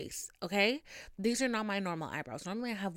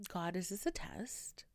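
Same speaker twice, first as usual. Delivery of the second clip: whispered, softer plea to the sky; exasperated whisper; 8 dB quieter than usual. Real, not acted. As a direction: breathe it out like a private prayer, worn out. Asking God in exasperation.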